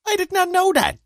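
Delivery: very high squeal of surprise; mock shock. Real, not acted. Friend feigning shock that the weatherman moved to Europe, in a squealing high voice. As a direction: shriek the words in exaggerated disbelief.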